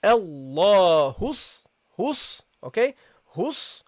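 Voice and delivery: Allahus said slowly, ha straight into the sad; lam dropped into sad. Shown as a demonstration. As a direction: skip the lam and slide hus into the s. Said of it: "So you say".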